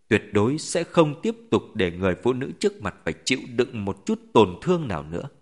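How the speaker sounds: ordinary delivery, nothing marked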